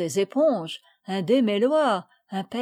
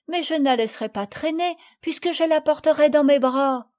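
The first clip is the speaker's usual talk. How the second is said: put-on higher, quick child voice, insisting; stubborn little girl; 6 semitones higher, 4 dB louder than usual. A narrator's reading. Marguerite insists to Madeleine, stubborn child voice. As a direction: argue back like a small child.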